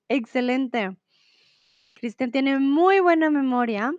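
usual speaking voice

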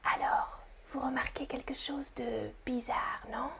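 soft, hushed whisper. Shown as a demonstration. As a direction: whisper the question to the listeners. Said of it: "et je chuchote".

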